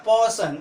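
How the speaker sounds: "पर्सन" as paw-sun, no R; "पर्सन" with silent R. Shown as a demonstration. Teacher answers own question, "पर्सन" said without R. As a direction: drop the R in "पर्सन". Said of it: "उसका उच्चारण क्या होगा".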